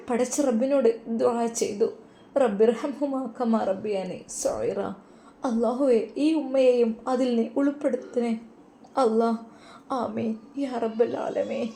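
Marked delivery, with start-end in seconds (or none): 2.4-11.7 slow, reverent prayer recital. Acted. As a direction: pray slowly and devoutly. (പ്രാർത്ഥനാ സ്വരം)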